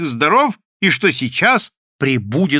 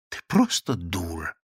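low, soft and tired; weary; 6 semitones lower, 5 dB quieter than usual. A narrator's reading. Доброгласов answers his wife, low, soft and tired. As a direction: let it out with no energy left. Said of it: "устало сказал Доброглас".

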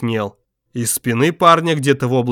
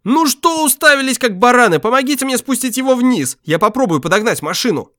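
angry yelling; 11 semitones higher than usual. Acted. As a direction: bark orders in rage. Yelling at frozen friends.

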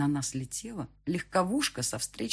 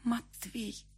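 soft, higher, weak whisper of his name; trembling whisper; 7 semitones higher, 6 dB quieter than usual. A narrator's reading. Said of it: "прошептала она трясущимися губами". (дрожащий шёпот)

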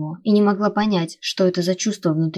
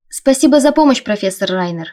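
loud thanks; 2 semitones higher, 7 dB louder than usual. A narrator's reading. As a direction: announce it loudly for bystanders to overhear. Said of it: "произнесла я достаточно громко".

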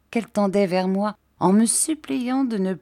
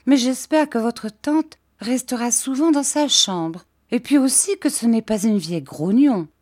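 put-on higher, lively girlish voice; high-pitched naive chatter; childish Dora, anxious; 7 semitones higher, 4 dB louder than usual. A narrator's reading. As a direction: fret like a young girl.

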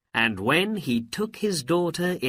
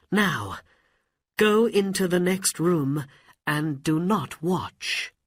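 Aladdin voice; 7 semitones higher, 4 dB louder than usual. Acted. Aladdin instructs princess.

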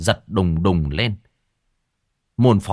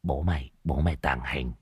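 much softer, low muttered chant; muttering; 8 semitones lower, 8 dB quieter than usual. Acted. Old Đồng muttering to himself in hiding. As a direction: mutter it under your breath. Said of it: "mồm lẩm bẩm".

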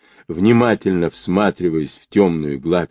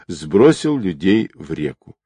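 usual speaking voice

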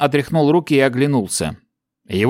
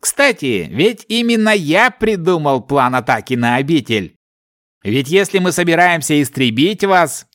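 smug villain; 4 semitones higher than usual. A narrator's reading. Villain boasts of his plan.

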